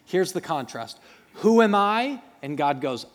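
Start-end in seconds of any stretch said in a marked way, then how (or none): 1.3-2.2 pleading Moses. Acted. Moses questioning God.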